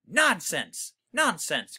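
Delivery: mild growl of a gruff father. Acted. Father dismissing the mother's fear, mild growl. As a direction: growl it out, lightly voiced. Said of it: "growled Mister Little".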